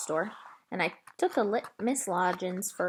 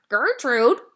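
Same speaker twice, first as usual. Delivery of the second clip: much louder parrot screech; parrot scream; 4 semitones higher, 11 dB louder than usual. A narrator's reading. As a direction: screech the name like an upset bird. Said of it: "she screamed again".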